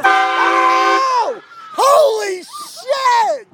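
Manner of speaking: startled scream. Real, not acted. Jump scare in haunted house.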